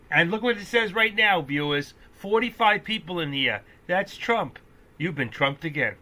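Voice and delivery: thrilled streamer hyping the count; excited announcement. Real, not acted. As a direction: shout the news to the viewers. Excited about viewer count.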